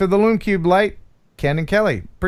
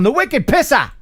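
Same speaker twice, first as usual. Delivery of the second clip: put-on high, punched Boston accent; attempted Boston accent; 8 semitones higher than usual. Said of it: "I can't do a Boston accent".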